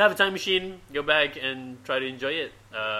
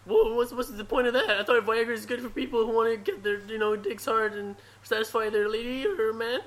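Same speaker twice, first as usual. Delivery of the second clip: higher, whiny stammer; Morty voice; 7 semitones higher than usual. Pretend. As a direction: stammer it out, pitched up. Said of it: "I can do Morty".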